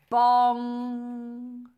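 loud, high bong with a long ringing hum; sung-out bell strike, held on the ng; ringing bell imitation. A narrator's reading. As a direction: strike it hard and let it ring.

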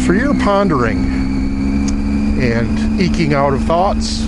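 muffled, close-miked helmet sound; inside a helmet. Real, not acted. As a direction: talk from inside a motorcycle helmet. Outro recorded in different setting.

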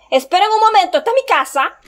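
high, loud disbelief; astonished. Real, not acted. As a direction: exclaim it in shocked realization. Shocked recognition of house.

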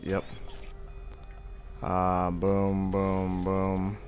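ordinary talk

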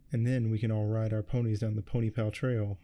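ordinary delivery, nothing marked

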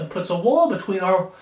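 usual speaking voice

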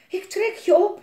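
high, loud strained call; panting shout. Acted. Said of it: "hijgde hij". (Jelmer roept hoog en luid terwijl hij Elise optrekt)

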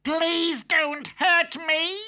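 high slow pleading wolf; yelping plea. Acted. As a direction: beg in a yelp. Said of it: "yelped the wolf".

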